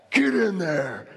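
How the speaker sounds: high, hollered angry order; mock-furious sideline command; livid coach. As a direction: imitate a fed-up coach yelling. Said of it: "He gets ticked, livid".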